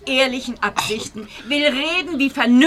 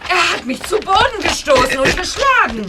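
indignant accusation; 6 semitones higher, 4 dB louder than usual. Acted. Frau beschuldigt Lackner aufgebracht.

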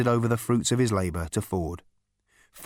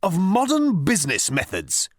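higher-pitched, lofty disdain; haughty; 9 semitones higher, 3 dB louder than usual. A narrator's reading. Management consultant putting Ford down, haughty. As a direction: finish the put-down loftily. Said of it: "good old-fashioned hauteur".

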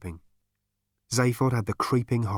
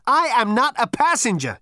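much higher and louder shout; 14 semitones higher, 8 dB louder than usual. A narrator's reading. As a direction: yell it in desperation. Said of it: "shouted Zaphod again".

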